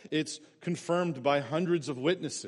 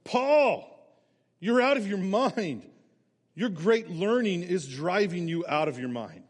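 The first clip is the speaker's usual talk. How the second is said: a scornful outburst, much higher and louder; 5 semitones higher, 5 dB louder than usual. Acted. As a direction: shout it high and loud at Paul. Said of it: "Festus said in a loud voice".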